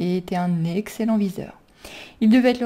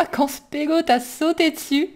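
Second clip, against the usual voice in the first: higher, laughing as he speaks; 6 semitones higher than usual. A narrator's reading. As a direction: gasp it out through laughter. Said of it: "Il arrivait vers eux en riant".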